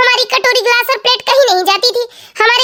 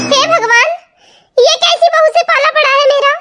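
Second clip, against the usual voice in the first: higher, half-laughing scolding mother-in-law; exasperated mother-in-law; 5 semitones higher than usual. Acted. (बहू को डाँटती, परेशान सास की ऊँची आवाज़)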